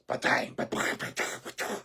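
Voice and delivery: breathy imitated clang of a bracelet on a desk; bracelet banging noise. Telling a stranger how the neighbour banged his bracelet, clanging noise. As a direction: mimic the metal smacking the desk.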